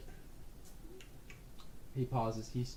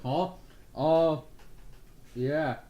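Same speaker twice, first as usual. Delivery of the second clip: chewing, caught off guard, louder; startled mumble through food; mouth-full grunt; 3 semitones higher, 6 dB louder, much slower than usual. Acted. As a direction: Porkins surprised mid-bite. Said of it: "He's like".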